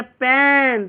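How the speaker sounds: "पेन" with a long held vowel; long sound. Shown as a demonstration. Teacher illustrates a long sound, stretched "पेन". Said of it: "वो long sound हो जाएगा".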